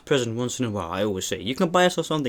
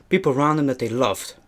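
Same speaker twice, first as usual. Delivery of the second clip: normal talking voice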